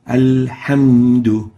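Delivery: alhamdu slow and loud with a clear breathy ha. Shown as a demonstration. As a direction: say alhamdu with a clean ha. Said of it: "For example".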